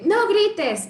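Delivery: mock parent yelling; shouting. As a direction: yell the very thing you forbid. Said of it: "y gritamos".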